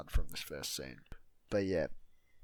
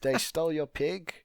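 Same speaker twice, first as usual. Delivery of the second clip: higher and louder, snapping like the truffle lady; mock furious outburst; 9 semitones higher, 9 dB louder than usual. Retelling the lady who snaps about the stolen pig, loud mock fury. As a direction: blow up at the news the pig was stolen. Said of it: "this lady just snaps".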